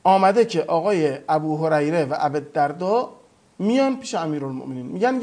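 ordinary talk

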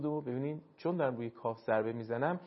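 ordinary talk

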